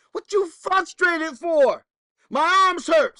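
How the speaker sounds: high, loud whiny complaint; frustrated student. Retold or imitated. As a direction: play the fed-up kid protesting. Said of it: "pupil complaining in the story".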